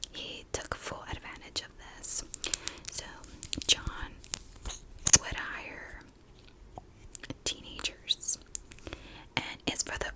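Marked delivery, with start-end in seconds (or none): none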